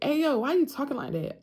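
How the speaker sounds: a hint of the brother teasing; imitating brother. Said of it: "He was like".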